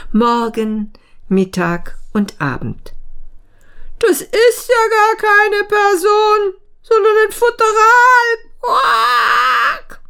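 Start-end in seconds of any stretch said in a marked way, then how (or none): 4.0-10.0 very high, loud frog shriek; furious croaking frog. A narrator's reading. Frog cheated of its meal, quacking furiously. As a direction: squawk in outrage, ending on a long quack. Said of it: "quakte der Frosch wütend".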